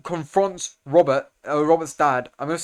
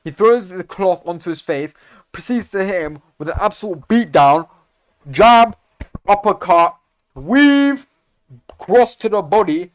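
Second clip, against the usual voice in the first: much louder, higher blow-by-blow calls; much louder, higher excited fight commentary; hyped play-by-play; 4 semitones higher, 9 dB louder than usual. Real, not acted. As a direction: call each punch like a ringside announcer.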